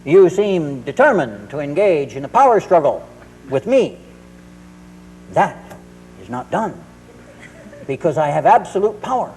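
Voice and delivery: hammed-up menace with weighty pauses; towering threat. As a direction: play the all-powerful parent, spacing each phrase out. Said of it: "towering above him".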